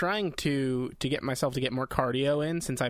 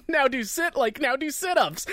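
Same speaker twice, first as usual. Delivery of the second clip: barked command; 10 semitones higher, 4 dB louder than usual. Retold or imitated. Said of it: "it'll be".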